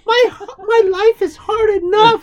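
very high, loud, laughing fake crying; mock sobbing Karen. Host parodying the letter writer, fake sobbing. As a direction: wail it in mock tears. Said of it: "she's like".

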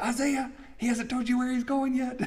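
gently ribbing the eager prophet; teasing warning. Retold or imitated. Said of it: "And I'm gonna go".

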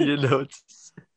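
high, loud laughing comeback; mock comeback. Friend firing back a comeback, high and laughing. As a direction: pitch it up and laugh it out. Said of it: "He's like".

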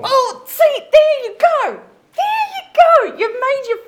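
very high, loud, squeaky mockery; mocking high voice. [name] mocking her mother in a very high squeaky voice.

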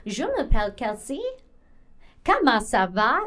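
careful French with rising lilt; standard French. Shown as a demonstration. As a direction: say it in textbook French from France. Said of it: "if I were to say, like".

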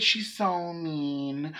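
soft, very slow drawn-out words; cooing owner. Retold or imitated. Imitating owner talking about her dog, softly cooing. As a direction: stretch it out in a hushed imitation.

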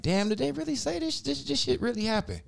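high shocked voice; stunned, checking if it's real; high stunned did-they-really; shocked disbelief. Retold or imitated. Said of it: "I'm like".

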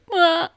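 high, loud wailing fake cry; Eddie Murphy cry. Shown as a demonstration. Demonstrating the kid's cry for the co-host, loud wail. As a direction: let out one big comic wail. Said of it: "which [name]'s gonna demonstrate".

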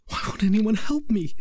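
high, desperate scream; a boy on death row screaming for help; anguished scream. A narrator's reading. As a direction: cry out in despair to no one. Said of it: "he screamed".

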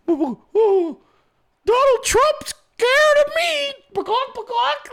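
very high, slow squawking bird; parrot voice. Pretend. Mocking Haley as a bird.

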